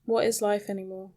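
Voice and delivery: ordinary talk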